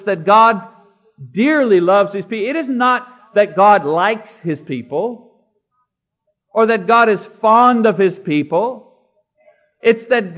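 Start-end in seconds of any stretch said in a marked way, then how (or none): none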